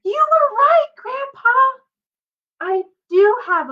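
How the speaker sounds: put-on boy shouting to his grandpa that he was right; excited shout. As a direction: yell it the moment you burst through the door. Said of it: "he shouted".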